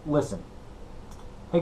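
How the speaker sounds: ordinary talk, nothing marked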